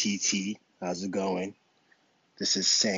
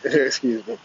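ordinary delivery

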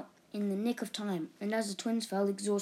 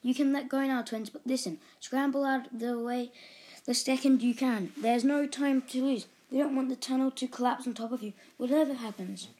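loud, higher urgent instructions; calling out; 5 semitones higher, 5 dB louder than usual. A narrator's reading. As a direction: shout orders down the tunnel. Father shouts warnings down tunnel.

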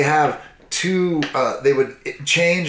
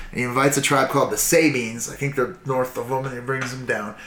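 ordinary delivery

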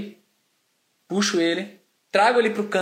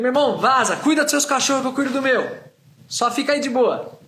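firm dismissal; 6 semitones higher, 5 dB louder than usual. Retold or imitated. Telling stranger to leave.

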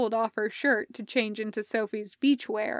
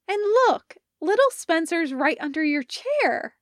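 cheerful Parker; 7 semitones higher, 5 dB louder than usual. Acted. Parker points out another crab.

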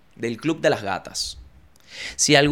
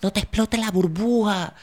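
mocking warning; 7 semitones higher than usual. Mocking people's advice about the blister, high voice. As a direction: parody someone fussing at you. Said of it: "y me dijeron".

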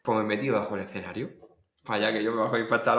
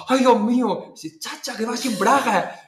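high, dismayed exclamation; comic alarm at an embarrassing discovery; retold shocked realisation; 10 semitones higher, 5 dB louder, slower than usual. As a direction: exclaim it scandalized, dropping the volume midway. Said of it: "y yo decía".

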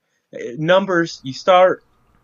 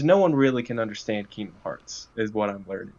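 usual speaking voice

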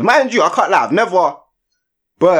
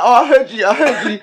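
teasing; 12 semitones higher, 5 dB louder than usual. Retold or imitated. Friends teasing him, laughing.

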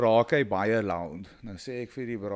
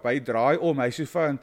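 usual speaking voice

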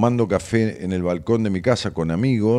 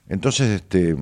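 normal talking voice

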